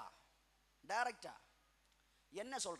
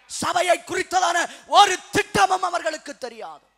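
raised, ringing pulpit voice; forceful preaching; 4 semitones higher, 9 dB louder than usual. Real, not acted. Preacher rebuking those ignorant of church plans, loudly. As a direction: declare it loudly to the whole hall.

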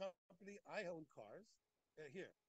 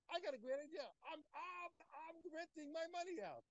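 voicing Rav Chama's idea; 10 semitones higher, 6 dB louder than usual. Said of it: "He says, he says".

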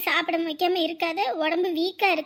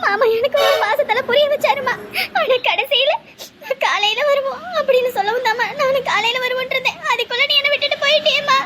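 anguished crying; 5 semitones higher, 4 dB louder than usual. Acted. Girl cries to mother's spirit.

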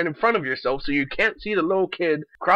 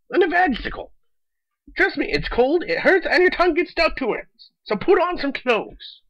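exasperated high-pitched rant; 10 semitones higher, 3 dB louder than usual. Real, not acted. Ranting at girls in short shorts, exasperated and much higher. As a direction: voice jumps much higher and louder, incredulous.

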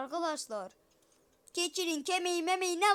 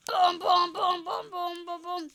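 shouted booms; 3 semitones higher, 7 dB louder than usual. Pretend. Shouted explosion noises.

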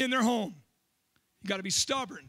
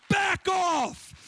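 mock warning shout at an intruder; fierce shout; 6 semitones higher than usual. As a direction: bark it like the hair on your neck stands up. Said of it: "and he's like".

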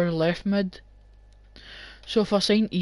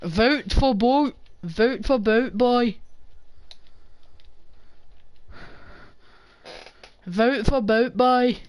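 slow, higher mock English campaign chant; mock English accent, slow and a bit higher; 4 semitones higher, slower than usual. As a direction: put on an English accent to rally voters. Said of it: "That's my best English accent".

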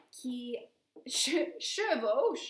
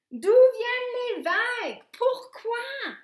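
excited; 9 semitones higher, 9 dB louder than usual. A narrator's reading. Sacha's excited questions.

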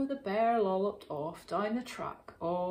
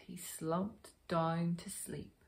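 plain reading voice

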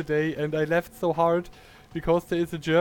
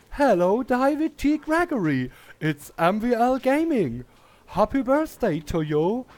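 excited greeting; 8 semitones higher, 6 dB louder than usual. Real, not acted. Excited shout-out to a viewer.

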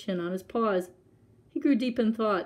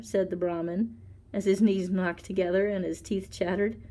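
ordinary delivery

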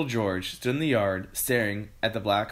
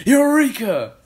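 very high, loud exclamation; triumphant cry; 11 dB louder, slower than usual. A narrator's reading. Mister Cranky hearing the missing ingredient, triumphant cry. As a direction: shout it out in sudden triumph. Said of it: "cried Mister Cranky".

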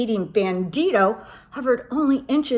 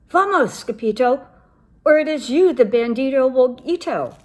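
louder, high warning cry; 5 semitones higher, 7 dB louder than usual. Acted. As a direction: shout the warning to flee. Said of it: "they cried".

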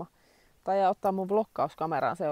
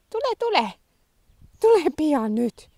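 coaxing the dog; 9 semitones higher, 10 dB louder than usual. Real, not acted. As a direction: draw the dog in with a long high call. Calling the dog.

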